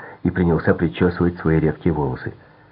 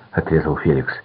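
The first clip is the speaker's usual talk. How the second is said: plain reading voice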